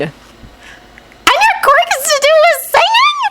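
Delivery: put-on very high, squealing girl; thrilled bouncing girl; excited squeal. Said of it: "Rebecca squealed excitedly".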